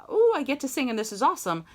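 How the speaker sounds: playfully voiced eager delight; excited student. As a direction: gush it quickly like a thrilled beginner. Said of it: "from the".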